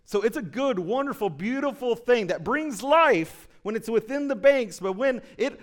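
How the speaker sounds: loud, soaring preacher's call from the pulpit; full-force preaching. Acted. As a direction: preach it out big to the whole church.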